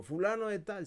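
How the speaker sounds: high, loud roll call; calling a name. Retold or imitated. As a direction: call the name across the room. Profesor llama nombres. (llamando el nombre en voz alta)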